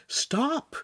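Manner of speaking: high-pitched plea at normal volume; urging. A narrator's reading. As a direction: urge him to stop, pitched high. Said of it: "Shamira urged Lincoln".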